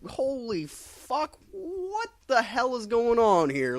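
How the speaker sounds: hammed-up whining shock. Real, not acted. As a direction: wail it out in horror. Reacting to Superman killing.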